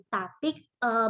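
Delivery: normal talking voice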